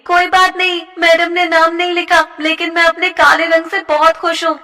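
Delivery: snooty schoolgirl. Acted. A mean schoolgirl lecturing a classmate, smug. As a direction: talk down to her sweetly.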